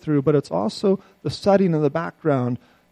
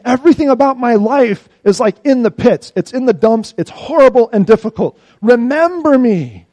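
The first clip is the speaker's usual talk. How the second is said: loud, high anguished plea; pleading for God to remember; crying out; 6 semitones higher, 10 dB louder than usual. Acted. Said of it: "Jeremiah is saying".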